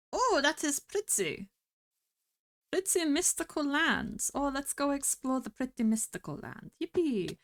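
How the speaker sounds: a wandering mock French accent that drifts into nothing real; vaguely French accent. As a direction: gush over the view in a fake French-ish accent. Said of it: "I don't know what accent this is meant to be".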